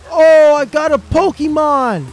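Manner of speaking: high, loud, slow cry of joy; thrilled shout. Real, not acted. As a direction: cheer over the catch. Catching a creature in game.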